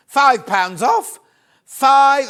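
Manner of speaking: loud, slow and excited about the saving; excited sales pitch. Real, not acted. Shopping presenter to viewers, loud excited discount announcement. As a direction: sell the discount with real excitement.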